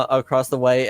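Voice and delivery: ordinary talk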